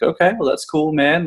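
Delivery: lifted, airy shrug of a reply; breezy brush-off. Retold or imitated. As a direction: toss it off lightly to change the subject. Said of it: "I was like".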